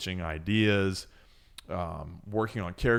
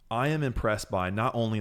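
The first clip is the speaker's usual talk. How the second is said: normal talking voice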